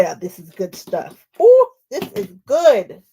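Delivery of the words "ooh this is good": high, loud, drawn-out ooh; delighted. Real, not acted. Admiring her freshly pressed quilt block, high and delighted. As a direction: savour it, thrilled with the result.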